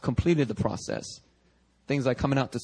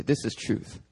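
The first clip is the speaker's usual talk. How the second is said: whispered truth; 5 semitones lower, 6 dB quieter than usual. Acted.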